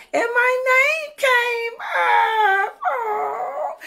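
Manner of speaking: high, slow, tearful rise; choked-up disbelief; joyful crying. Real, not acted. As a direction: voice cracking up high with happy tears. Telling how she won.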